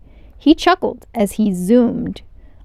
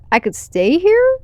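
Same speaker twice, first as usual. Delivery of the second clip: high, amazed question; can't believe his luck; astonished; 9 semitones higher, 5 dB louder than usual. A narrator's reading. Elio astonished at the offer.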